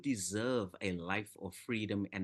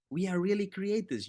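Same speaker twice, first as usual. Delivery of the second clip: rising exclamation; 9 semitones higher than usual. Shown as a demonstration. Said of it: "I love that".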